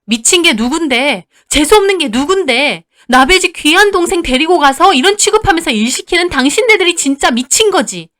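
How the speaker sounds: high, loud furious tirade; enraged retort. Acted. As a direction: fling the insult straight back. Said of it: "언니는 그 말에 눈이 돌았어요". (눈이 뒤집혀 소리치는 언니)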